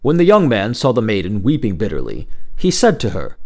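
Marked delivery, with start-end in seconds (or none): none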